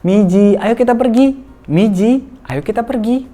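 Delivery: put-on high, loud sing-song call to a child. Shown as a demonstration. Parent calling a playing child in high sing-song voice. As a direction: call the child to come along. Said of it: "misalnya namanya Miji".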